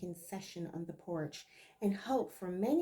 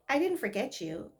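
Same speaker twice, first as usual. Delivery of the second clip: plain reading voice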